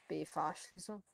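ordinary talk, nothing marked

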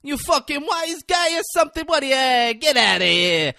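hammed-up Italian wise-guy impression; Braden. Host mocks co-host's habit, loud exaggerated Italian accent. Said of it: "doing an Italian accent".

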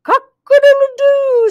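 loud, very high rooster crow; crowing cat. A narrator's reading. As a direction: crow at full stretch. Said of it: "said the cat".

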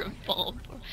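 hushed mock-secret whisper. Echoes friend's whisper.

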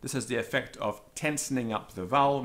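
normal talking voice

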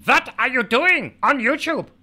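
shrill barked German officer interrogation; Gestapo impression. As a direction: bark it like a movie Nazi officer. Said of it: "my Gestapo impression".

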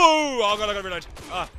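high, loud panicked cry; panicked yell. Real, not acted. Gamer jump-scared by an enemy, panicked yell. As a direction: yelp in fright, then rush.

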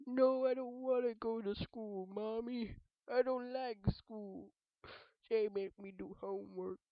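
put-on high, slow whining kid; whiny child. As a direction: whine to Mommy like a schoolboy. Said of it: "I sound like I'm crying".

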